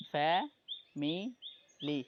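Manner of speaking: "ফ্যামিলি" very slowly as fa, mi, li; three separated beats on "ফ্যামিলি"; syllable by syllable. Shown as a demonstration. Teacher showing three-syllable words, "ফ্যামিলি" slowly split fa-mi-li. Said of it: "trisyllabic words".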